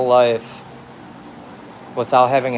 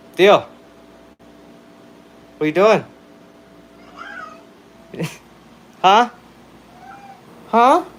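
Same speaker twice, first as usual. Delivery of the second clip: much higher and louder playful calls; calling the cat; 10 semitones higher, 6 dB louder than usual. Real, not acted. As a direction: shout playfully to the cat across the house.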